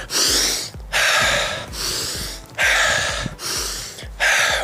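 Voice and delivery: repeated big, audible breaths; deep recovery breathing. Shown as a demonstration. Coach shows players it feels good, deep recovery breathing. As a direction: breathe deep and let it rush out. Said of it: "It feels good".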